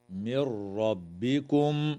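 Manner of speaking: slow recitation, stopping on ربكم; stop demonstration. Shown as a demonstration. Teacher showing students the acceptable stop. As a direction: merge من into ربكم and stop there. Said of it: "من قبيل الوقف الحسن".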